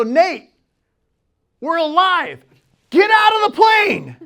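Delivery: high, loud command, reenacted; building to a full shout; urgent shouting. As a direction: yell to get him moving. Reenacts ordering panicked boy out.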